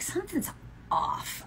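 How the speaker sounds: soft, dropping to a breathy near-whisper; suspicious hush. Retold or imitated. Telling friend about the cheap dog, hushed suspicion. Suspecting a scam.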